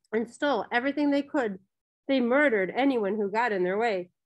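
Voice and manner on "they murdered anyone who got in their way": ordinary delivery, nothing marked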